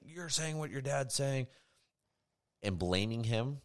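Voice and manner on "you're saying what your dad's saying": high, slow mock accusation; accusing. Imagined parent blaming the son at dinner, drawn-out high accusation. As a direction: imitate a parent pointing the finger. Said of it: "pointing the finger at him".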